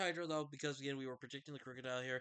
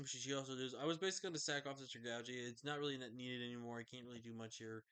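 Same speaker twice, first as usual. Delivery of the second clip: ordinary talk, nothing marked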